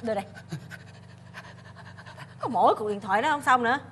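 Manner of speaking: ordinary talk, nothing marked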